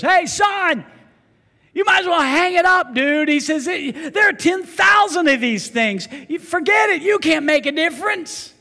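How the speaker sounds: high, loud voice calling down the beach; loud, high shouted discouragement; shouting stranger. Retold or imitated. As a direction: play a man yelling the boy should quit. Man on beach shouting at boy.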